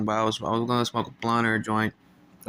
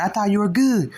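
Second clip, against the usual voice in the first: loud protest; 10 semitones higher, 6 dB louder than usual. Retold or imitated. Replying to OG.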